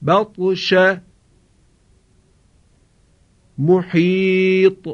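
slow tajwid, the ط of محیط bounced with a light echo; recitation with qalqala. Shown as a demonstration. Teacher models the ta examples for students. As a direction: chant each word slowly and echo the ta.

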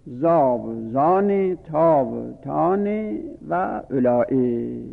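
زا و زان، تا و تان drawn out slowly, each syllable held; slow recitation. Shown as a demonstration. Teacher to class, reciting زا و زان، تا و تان slowly. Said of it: "پس این پنج اسم از اسماء اشاره اصولند".

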